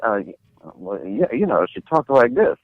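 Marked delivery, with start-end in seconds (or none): 1.7-2.6 lightly imitated film star's speaking voice; a hint of a singer's talking voice. Shown as a demonstration. Showing the host how the singer talked, light impression. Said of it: "[name] had a very low voice".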